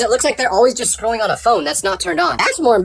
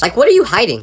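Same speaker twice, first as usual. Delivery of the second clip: ordinary talk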